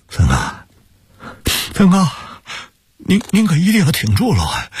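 high strained sobbing voice; choking with tears. Acted. Brother grips his arm and confirms the death, choked with tears. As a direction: plead through tears for him to hold on. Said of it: "哽咽着".

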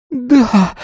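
high, strangled yelp of fear; terrified rasp. A narrator's reading. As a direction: squeak out in terror. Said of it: "перепуганный хрип".

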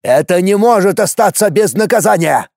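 choking on his own yell; furious yell. Acted. Warrior screams at the mother for punishment, furious. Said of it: "чуть не захлебнулся от вопля".

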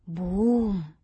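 loud, drawn-out scoffing boom; mocking, disbelieving hoot; incredulous. A narrator's reading. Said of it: "un'altra voce con incredulità".

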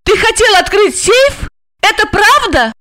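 high-pitched urgent interrogation; shrieked female questions; crying out. A narrator's reading. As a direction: press him for an answer, shouting. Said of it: "закричала она".

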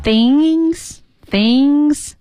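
high, drawn-out coquettish whine. Shown as a demonstration. Teacher mimicking a pouty English word for learners, coquettish. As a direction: stretch it out high and cutesy. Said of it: "撒娇的语气".

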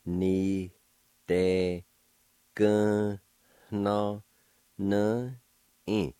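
each of "Ni de gun no no in" held separately; slow syllables with pauses between. Shown as a demonstration. Teacher pronouncing word number four for learners, one syllable at a time. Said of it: "now I'll pronounce these words".

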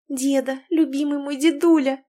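high, emotional greeting; granddaughter's high-pitched delight at her grandfather; overjoyed endearment. A narrator's reading. Granddaughter embracing her beloved grandfather.

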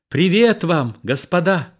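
high, elated hail; lively greeting. A narrator's reading. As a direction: greet the assembled men brightly, from above. Roxane leaps out greeting soldiers.

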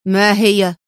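impatient, irritated short question; narrated man's mild exasperation; fed-up question. A narrator's reading. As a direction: ask as if sick of waiting. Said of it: "سألها في ضجر".

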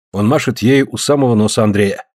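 plain reading voice

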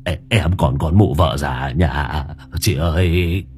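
low, halting, pleading wail; terrified old man begging. Acted. Old man begs the ghost woman for mercy, quavering plea. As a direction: stammer and draw out the pleas.

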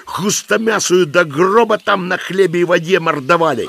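put-on very high, ranting old husband; old man cursing. A narrator's reading. Old husband threatens wife with the monastery, furious rant.

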